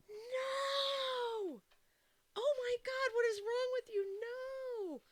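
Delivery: high, loud anguished yelling; screaming at the TV. Retold or imitated. Gamer screaming at the TV as a seller gives rare cards away, high anguished yell. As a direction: scream in disbelief at the screen. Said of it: "we're just screaming at the T V".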